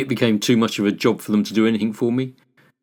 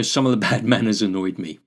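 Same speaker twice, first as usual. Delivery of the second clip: ordinary delivery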